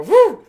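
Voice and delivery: high, loud ghostly woo; spooky woo. Retold or imitated. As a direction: howl it high to spook her. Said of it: "make that noise and go".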